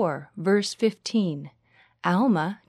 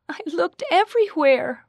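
high, louder protest; distressed; 11 semitones higher, 5 dB louder than usual. A narrator's reading. Rachel telling her friend she searched, distressed. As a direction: insist in distress, pitched well up. Upset girl who lost knitting.

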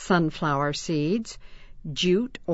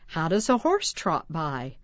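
usual speaking voice

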